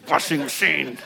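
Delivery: low, soft, weary mutter with a sigh. Real, not acted. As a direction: sigh the words out, worn down. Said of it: "now I'm all emotionally distraught".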